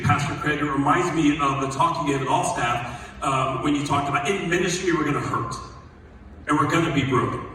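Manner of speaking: ordinary delivery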